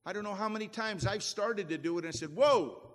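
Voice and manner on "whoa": startled whoa. Retold or imitated. Retelling stopping his own prayer.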